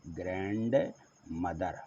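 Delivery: ग्रैंडमदर said very slowly and softly; slow model pronunciation. Shown as a demonstration. Teacher slowly dictating "ग्रैंडमदर" to pupils. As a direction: draw out "ग्रैंडमदर" gently for writing. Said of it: "यहाँ ग्रैंड लिख लें".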